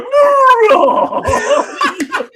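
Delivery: high, loud hammed-up villain cry of no; mock villain wail. Imitating the villain on the show, over-the-top no. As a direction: ham up the villain's no, no, no. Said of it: "when he was sitting there going".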